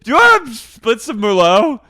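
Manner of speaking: high, loud, boozy sing-song; drunk Miranda. Retold or imitated. Miranda urging friends to split a bottle, loud boozy voice. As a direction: push the whole bottle on everyone with a big tipsy voice. Voicing Miranda offering Merlot.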